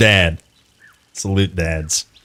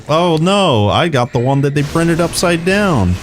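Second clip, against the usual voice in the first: high-pitched mock dismay; imitated joking dad; 7 semitones higher than usual. Dad holding the menu upside down, in mock dismay. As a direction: play up the fake misfortune like Dad. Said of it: "He'd be like".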